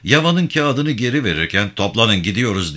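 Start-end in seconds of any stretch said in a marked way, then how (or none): none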